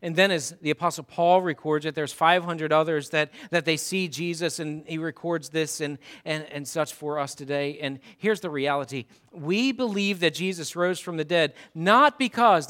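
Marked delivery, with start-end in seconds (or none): none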